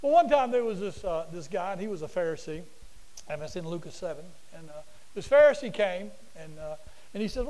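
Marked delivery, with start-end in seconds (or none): none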